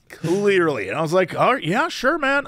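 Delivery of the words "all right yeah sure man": easygoing, noncommittal brush-off; very casual. Retold or imitated. Driver humoring an aggressive stranger, casual. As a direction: agree lightly, as if it's nothing. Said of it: "I was just very casual".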